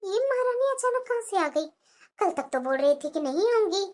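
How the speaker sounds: soft, low-pitched grumble; hushed, disgruntled private thought; resentful aside. Acted. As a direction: say it under your breath, not meant for her ears. Resentful aside before greeting the arrival.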